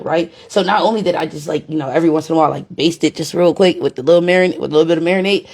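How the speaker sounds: ordinary talk, nothing marked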